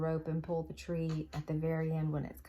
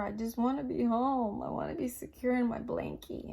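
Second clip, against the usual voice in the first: lightly voiced whiny little kid, a bit higher; mock your own self-pity like a child wanting bed; 6 semitones higher than usual. Tired woman mocking her self-pity, lightly childish whine.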